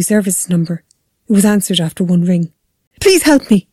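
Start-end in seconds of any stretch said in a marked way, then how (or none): 3.0-3.7 high, urgent cry for help; raised, desperate plea; bursting-out plea. A narrator's reading. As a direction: beg the emergency operator in panic. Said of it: "she burst out".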